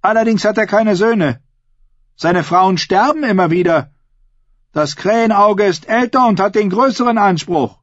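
high, projected oratory; ringing public speech, pitched high; bold woman making her case. A narrator's reading. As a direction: argue the claim before the whole gathering.

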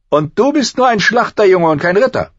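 high, loud contemptuous prince; scornful outburst. A narrator's reading. Prince belittling the butcher's boy, loud scorn.

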